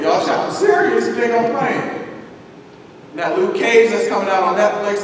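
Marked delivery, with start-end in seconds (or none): 0.0-2.2 loud, high call to the congregation. Real, not acted. Preacher calling the congregation to listen. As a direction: rouse the room, voice up high.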